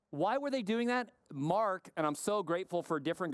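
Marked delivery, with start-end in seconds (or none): none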